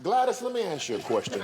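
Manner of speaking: lightly voiced coach laying on the charm. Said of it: "puts that old charm on her".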